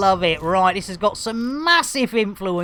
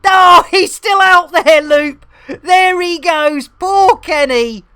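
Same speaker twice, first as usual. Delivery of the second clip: excited call; 7 semitones higher, 8 dB louder than usual. Real, not acted. Watching Kenny drift away.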